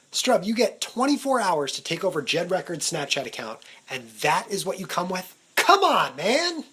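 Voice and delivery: loud, exasperated self-telling-off; mock scolding. Vlogger berating himself over his weak list, exasperated.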